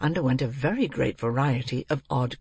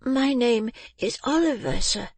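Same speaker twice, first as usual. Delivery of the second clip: put-on high child's voice; astonished little boy; 9 semitones higher than usual. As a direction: correct him in polite surprise, pitched high. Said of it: "with a look of great astonishment".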